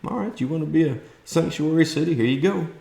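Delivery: a high, offhand "okay, all right" before the retort; mock Abbott, high and casual. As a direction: toss it off like a dare. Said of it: "He just said".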